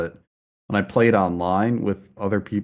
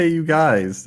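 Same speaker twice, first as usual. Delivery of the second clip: Goonies quote; 6 semitones higher, 4 dB louder than usual. Retold or imitated. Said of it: "he says".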